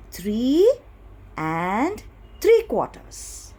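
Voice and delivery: slow, drawn-out words with rising pauses; reading it out. Shown as a demonstration. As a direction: read it out slowly, piece by piece. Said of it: "So how will I read this number?".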